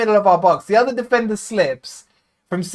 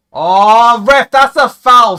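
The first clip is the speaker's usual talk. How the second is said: loud outraged appeal for a foul; furious fan shouting at the ref; 3 semitones higher, 9 dB louder, slower than usual. Real, not acted. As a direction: yell it at the referee.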